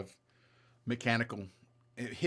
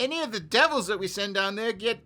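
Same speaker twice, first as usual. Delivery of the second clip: a noble's complaint climbing higher and louder into a frantic rant; frustrated Baron; 9 semitones higher, 6 dB louder than usual. Acted. Baron complaining to the adventurers about his mine workers, rising to a frantic high rant. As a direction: build from grumbling to a shrill, fast outburst about useless workers.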